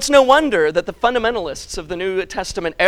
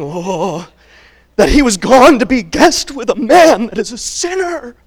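loud, whiny complaint of the fundamentalists; murmuring grumblers; 4 semitones higher, 9 dB louder than usual. Retold or imitated. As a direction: gripe like a scandalised churchgoer. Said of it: "They murmured, saying".

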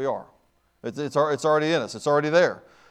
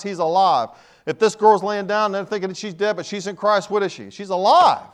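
raised, punchy pulpit delivery; emphatic preaching; 3 semitones higher, 5 dB louder than usual. Real, not acted. Preacher telling the church that in Christ it's alive, emphatic preaching. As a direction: drive it home, punching each key word.

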